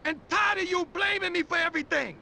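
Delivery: high, rapid angry tirade; fed-up outburst. Acted. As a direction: fire back, fast and high, fed up. Quarrel over blame and the empire.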